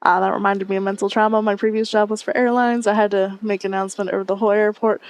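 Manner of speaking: normal talking voice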